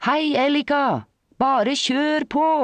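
high, drawn-out calling after someone; cheerful call. A narrator's reading. Friend at the gable window calls to the passing cyclist, cheerful call. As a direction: call out merrily, stretching the words. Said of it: "en munter stemme ropte etter henne".

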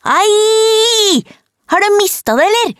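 very high, piercing yell; sudden scream. A narrator's reading. Supposedly dead girl yells at her poker, very high scream. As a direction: scream in outraged protest. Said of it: "ropte hun".

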